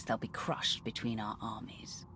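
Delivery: soft, gloating whisper; quiet threat; menacing whisper. Acted. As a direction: savour the threat under your breath. Gloating over the enemy's defeat.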